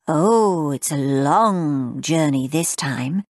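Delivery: slow, low, velvety conductor voice; smooth conductor's drawl. A narrator's reading. Uncle as conductor announcing the journey, slow and low. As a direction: drawl it like a night-train conductor. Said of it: "in an entirely new voice, smooth, velvety, a conductor's drawl".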